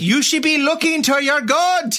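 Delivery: high, slow put-on Irish lilt; mock Irish accent. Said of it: "I don't know why it sounded Irish".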